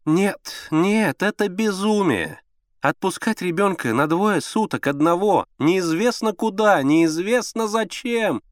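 high, drawn-out protest; fretful, dramatic wife; capricious. A narrator's reading. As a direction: lament it as sheer madness. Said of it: "капризным голосом ответила Алла Сергеевна".

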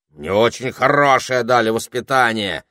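loud, offended wolf protest; wolf protesting his upbringing; indignant wolf. A narrator's reading. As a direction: bristle at the insult. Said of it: "возмутился волк".

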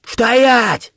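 high, sudden command; abrupt, high-pitched order; sharp shout. A narrator's reading. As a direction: halt him with a sharp cry. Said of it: "услышав резкий окрик".

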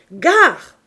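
one very loud, high cry; explosive high shout; warning shout. A narrator's reading. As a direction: yell a threat before hurling something. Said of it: "cria-t-elle".

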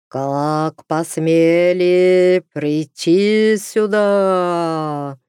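slow, loud eerie wail; otherworldly howl. Acted. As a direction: draw it out like a ghost. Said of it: "Снова завыл потусторонний голос".

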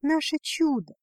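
ordinary delivery

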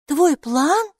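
can't believe it; amazed. A narrator's reading. Girl astonished by the robe's plan, amazed question. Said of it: "изумилась Майки".

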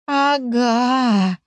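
high, drawn-out doggy whine; whimpering dog. A narrator's reading. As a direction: whimper it like a guilty pup. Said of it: "проскулила Ириска". (жалобно скулит собачонка)

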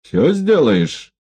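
slow, drawn-out sly question; sly menace. A narrator's reading. Егоров медленно и хитро переспрашивает одутловатого. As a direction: squint and draw it out. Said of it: "прищурился на него Егоров".

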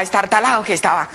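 ordinary talk

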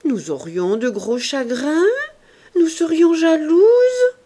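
hammed-up whiny mock lament, rising high and loud at the end; mock-tearful falsetto jab; funny mocking voice. A narrator's reading. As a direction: tease her with an overdone sob in the voice. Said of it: "Maxime prit une voix drôle".

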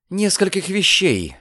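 high, short answer; terse girl's reply; curt retort. A narrator's reading. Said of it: "коротко отрезала девушка".

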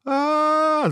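very high, loud sustained scream. A narrator's reading. Hero screaming at the dragon's heads, high scream. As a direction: scream out for the third time. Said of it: "закричал герой в третий раз".

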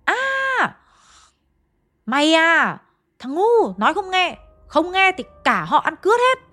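high-pitched, hammed-up abusive scolding; possessed cursing. Possessed medium points at the eldest son, cursing angrily. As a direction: curse them out as the angry ancestor. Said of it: "mà chửi nhá".

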